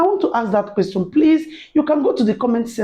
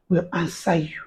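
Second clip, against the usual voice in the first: usual speaking voice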